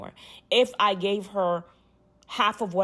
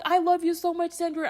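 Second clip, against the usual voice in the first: put-on tearful drunk; 8 semitones higher than usual. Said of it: "She's like".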